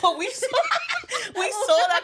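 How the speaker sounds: giggling through the words, pitch jumping high; laughing in disbelief at her own story; breaking into giddy high laughter. Real, not acted. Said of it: "I don't even know if we're allowed to".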